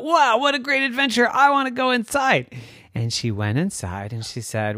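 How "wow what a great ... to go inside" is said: put-on high, eager little-girl voice; excited story girl. A narrator's reading. Maven spotting a cave to explore, high and excited. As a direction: pitch it way up with eager wonder.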